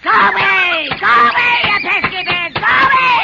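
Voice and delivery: high, loud shriek at the birds; angry shout. Acted. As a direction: scream to chase the birds off. Witch shooing birds away.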